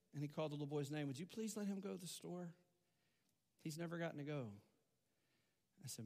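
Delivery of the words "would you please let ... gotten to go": put-on soft pleading boy. Boy pleading for his friend.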